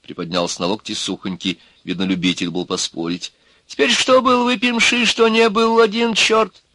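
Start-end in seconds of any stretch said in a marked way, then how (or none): 3.7-6.6 high, louder little-man voice; argumentative wizened man. Acted. Wizened arguer dismisses the excuse, argumentative. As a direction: insist in a high, disputing voice.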